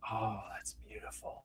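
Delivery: whispered wonder, very soft; awed whisper. Acted. Inner self admiring all the work, awed whisper.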